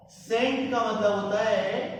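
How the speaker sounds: normal talking voice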